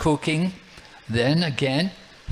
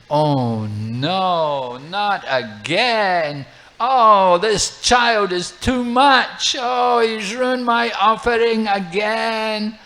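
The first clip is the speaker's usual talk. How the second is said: higher, louder, drawn-out despairing groan; exasperated wail; 6 semitones higher, 5 dB louder, much slower than usual. Acted. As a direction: wail it like it's happened once too often. Brahmana dismayed a second time.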